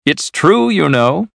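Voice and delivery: high-pitched, excited insistence. A narrator's reading. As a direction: burst in with amazing news. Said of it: "looking very excited".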